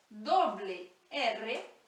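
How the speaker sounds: ordinary talk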